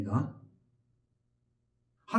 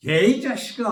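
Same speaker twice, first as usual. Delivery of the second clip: loud, high-pitched outburst of rebuke; acted angry scolding; 7 semitones higher, 10 dB louder than usual. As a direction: bellow it like an exasperated scolding. God scolding the complaining Israelites. (버럭 호통치는 연기)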